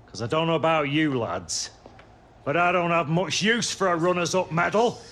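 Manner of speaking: ordinary talk, nothing marked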